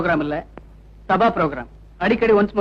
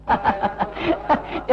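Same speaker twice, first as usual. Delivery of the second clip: laughter rising in pitch; mocking laugh; 3 semitones higher, 5 dB quieter than usual. Rowdy heckler to the pleading singer, mocking laugh. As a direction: laugh off the polite plea.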